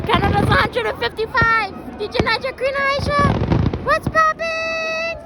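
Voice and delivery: high-pitched hype greeting, rising as it goes; excited shout-out. Real, not acted. Vlogger greeting viewers on Canada Day, excited. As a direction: hype up the viewers, stretching the last words.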